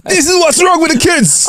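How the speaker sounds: high-pitched outraged yell; mock rant. Comedian pretending to rant down the school phone, mock-outraged yell. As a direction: cry it out in comic outrage. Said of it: "and just start blazing M J".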